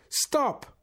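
high, loud desperate shout; yell. A narrator's reading. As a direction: yell it out in sudden protest. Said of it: "forced himself to his feet and yelled".

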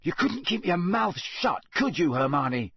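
telling her off for spoiling it; angry. A narrator's reading. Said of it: "said Ron angrily".

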